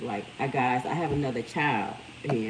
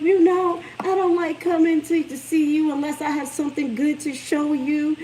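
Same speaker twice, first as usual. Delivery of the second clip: put-on higher, louder girlish voice for the daughter; imitating her daughter; 10 semitones higher, 6 dB louder than usual. Mom voicing her daughter's reason for staying away, higher and louder. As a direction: voice the young daughter, sweet and eager. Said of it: "she was like".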